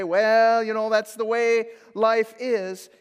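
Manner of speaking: shrugging dismissal. Pretend. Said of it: "He can't say".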